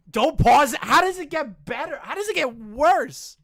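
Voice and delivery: high, loud, incredulous questions; incredulous outburst. Real, not acted. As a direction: blurt it out in loud amazement. Exclaiming over the story.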